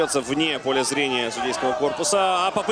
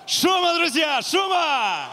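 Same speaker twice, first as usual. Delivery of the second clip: calling out; 11 semitones higher, slower than usual. Real, not acted. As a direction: rouse the arena, shouting. Host rousing the crowd.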